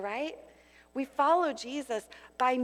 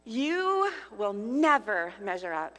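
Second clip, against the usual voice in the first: harsh, louder accusing voice of the enemy; accusing inner voice; 2 semitones higher, 5 dB louder than usual. Acted. Preacher voicing the enemy's lies to her congregation, harsh and accusing. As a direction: hammer the listener with shame.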